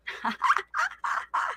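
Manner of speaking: high, quiet, breathy laughter; light airy chuckle. Real, not acted. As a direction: giggle softly at what you just read. Amused by the child's message.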